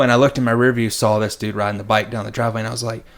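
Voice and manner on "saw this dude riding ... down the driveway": ordinary talk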